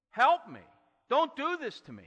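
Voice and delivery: high, loud desperate cry; retold scream for help. Joseph in the pit begging his brothers, loud desperate scream. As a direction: scream it like a boy trapped in a pit. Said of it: "he's screaming".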